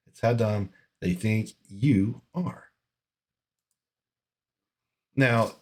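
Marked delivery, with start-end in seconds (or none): 0.1-2.6 drawn out and quieter, word by word; slowed, disgusted restatement; slow emphatic repeat. Real, not acted. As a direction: drag it out so it sinks in. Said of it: "repeats the phrase".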